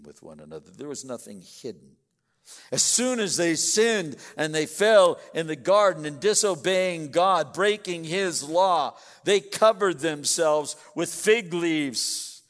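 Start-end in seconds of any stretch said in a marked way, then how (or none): 2.7-12.4 raised, forceful pulpit voice; sermon emphasis building on destroy; preaching. Acted. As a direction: press the point hard to the congregation. Pastor drives home his point.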